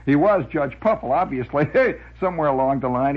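usual speaking voice

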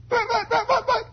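put-on very high stuttering boy; stammering kid. Boy stuck for words before his father, high stutter.

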